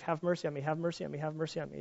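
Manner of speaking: soft, pleading plea; begging for my life. Acted. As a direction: quietly beg for mercy. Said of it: "He's pleading for his life".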